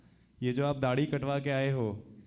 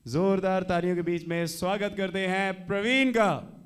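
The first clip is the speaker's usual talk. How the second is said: loud, raised host announcement; announcer intro; 5 semitones higher, 4 dB louder than usual. Acted. Host introducing Praveen on stage, loud and hyped. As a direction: hype the next act to the crowd.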